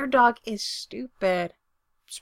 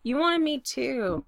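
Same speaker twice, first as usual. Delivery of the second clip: slow, higher, mild yell; 4 semitones higher, slower than usual. A narrator's reading. Spencer to Brendan upstairs, a mild yell. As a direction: raise the pitch, drawing the words out. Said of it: "He yells".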